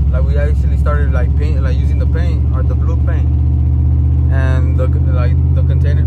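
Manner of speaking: loud, urgent rush of news. Real, not acted. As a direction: blurt out what just went wrong. Paint container leaking, urgent update.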